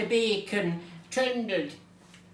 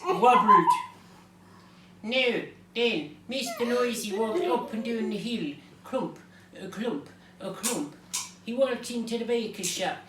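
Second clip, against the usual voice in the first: hammed-up Irish storyteller; Irish accent. Reader telling tonight's Mister Noisy book, Irish accent. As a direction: read it out in a broad Irish accent. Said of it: "we'll be doing it in an Irish accent".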